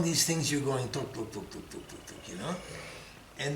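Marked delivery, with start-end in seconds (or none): 1.1-1.7 quiet tuk, tuk, tuk, said softer, like a coaster climbing; soft clicking noise. Retold or imitated. Imitating the slow coaster clicks. 1.7-2.3 tuk, tuk, tuk, breathed out as a whisper with no voice; whispered clicking noise. Retold or imitated.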